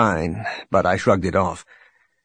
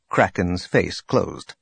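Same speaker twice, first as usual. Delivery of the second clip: ordinary delivery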